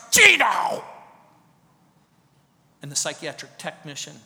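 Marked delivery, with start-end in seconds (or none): 0.1-0.8 loud, high shout of the name; retold shout. Strapped patient shouting at the preacher, loud high cry. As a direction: snap the name out at him. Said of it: "And she goes".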